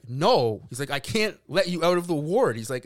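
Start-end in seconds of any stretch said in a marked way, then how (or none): 0.1-0.7 loud, emphatic no at usual pitch; firm retold refusal. Staff member refusing, retold. 0.9-2.6 louder, higher, emphatic staffer; raised voice, holding firm; insistent retold refusal.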